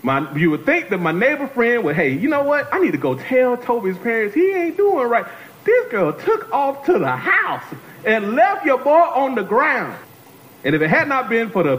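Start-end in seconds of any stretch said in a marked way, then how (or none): 2.0-10.0 high, emphatic complaint, slowing at the end; mock indignation. Preacher faults the neighbor friend who left him, indignant. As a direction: build an exasperated case, pitched up.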